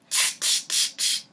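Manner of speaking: whispered tch tch; soft mocking tut-tut; monkey tutting. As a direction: tut back at him like a cheeky monkey. Monkeys mock the peddler.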